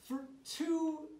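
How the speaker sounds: high, loud, drawn-out disbelief. Retold or imitated. Son stunned that dad kept the joke going: incredulous. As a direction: stretch out the years, incredulous.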